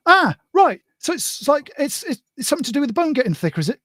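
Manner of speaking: high-pitched dawning realisation; retold sudden realisation. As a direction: work it out eagerly, high and quick. Re-enacts working out the diagnosis.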